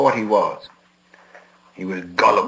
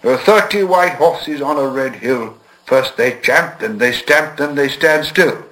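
recited riddle; 5 semitones higher, 4 dB louder, slower than usual. A narrator's reading.

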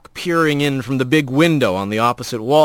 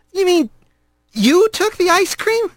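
much higher, stunned question; stunned young rat. A narrator's reading. Young rat confronting the girl, stunned and high. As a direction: realise it with disbelief.

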